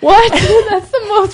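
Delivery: laughing disbelief. Real, not acted. Listener reacts in disbelief.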